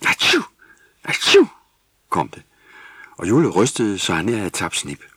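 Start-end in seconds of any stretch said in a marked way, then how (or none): 0.1-1.5 two explosive, high sneezes; big storybook atchoo; acted sneeze. A narrator's reading. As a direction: sneeze loudly, twice. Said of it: "før Jule nyste".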